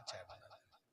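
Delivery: whispered mock echo of his plea. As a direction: breathe the words back at him mockingly. Wife mocks husband's request. (फुसफुसाकर ताना मारते हुए नक़ल)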